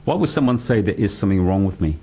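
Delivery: normal talking voice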